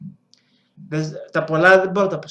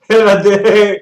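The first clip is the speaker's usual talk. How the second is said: loud exclaim; 12 dB louder, much slower than usual. Real, not acted. Reacting to game.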